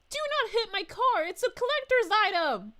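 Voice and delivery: high, loud mock protest; mock panicked yell. Reading a chat joke about blue shells, high mock shout. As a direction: yell it like a kart racer guarding a prized car. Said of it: "I'd be like".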